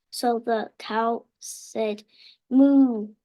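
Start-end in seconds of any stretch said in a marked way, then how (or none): 2.5-3.1 loud cow moo. Acted. Child voices the cow.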